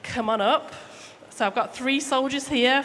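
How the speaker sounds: ordinary delivery, nothing marked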